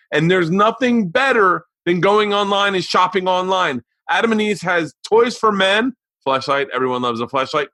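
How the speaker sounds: high, punchy announcer delivery; sponsor ad read. Real, not acted. Host opening the sponsor spot to listeners, punchy ad read. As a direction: pitch the sponsor with energy.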